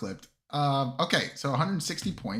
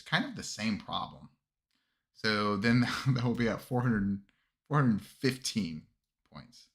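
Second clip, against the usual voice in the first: ordinary talk, nothing marked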